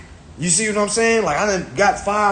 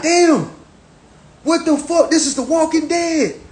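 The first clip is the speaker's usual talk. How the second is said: shocked; 12 semitones higher than usual. Retold or imitated. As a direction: blurt it out in disbelief. Shocked reaction to realization.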